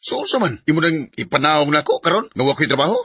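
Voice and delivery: loud, high, defensive outburst; angry shout. Acted. Jobless husband yelling at his wife for nagging him. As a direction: snap back at her, stung and furious.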